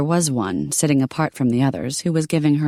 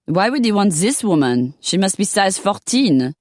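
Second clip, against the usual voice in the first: put-on higher, scornful French accent; disdainful French accent; 6 semitones higher than usual. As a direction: sneer it like a rude Frenchwoman.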